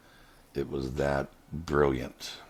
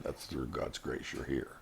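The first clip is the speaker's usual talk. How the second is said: hushed, softer comfort, retold; gentle reassurance; 7 dB quieter than usual. Reassuring a friend she survived by grace, hushed and tender. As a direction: soothe her with quiet faith. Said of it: "I was trying to reassure her".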